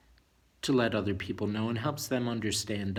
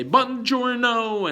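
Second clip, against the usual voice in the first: slow, louder and higher Buongiorno; Italian greeting; 11 semitones higher, 9 dB louder, much slower than usual. Shown as a demonstration. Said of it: "some people say".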